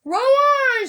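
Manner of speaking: high, loud call to the rowers; shouted order. A narrator's reading. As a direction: bellow it from the boat. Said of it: "shouted Mister Wonka".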